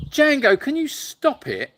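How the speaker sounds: high-pitched, drawn-out telling-off; exasperated scolding. Real, not acted. Host breaks off to tell Django to stop it, exasperated scolding. Scolding bickering animal mid-stream.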